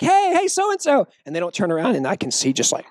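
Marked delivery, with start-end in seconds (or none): 0.0-1.1 high-pitched hallway hail; lightly acted call to someone ignoring you; imitated calling out. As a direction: mimic trying to get a friend's attention.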